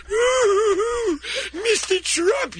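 very high, slow swooning coo; fawning swoon. Retold or imitated. Piers Morgan swooning over Trump, mocked in a high coo. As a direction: coo like a starstruck fan. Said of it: "drops all of his moral conviction".

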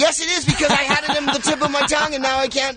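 high-pitched exasperation; frustrated. Real, not acted. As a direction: wail it out, exasperated at yourself. Frustrated at forgetting a title.

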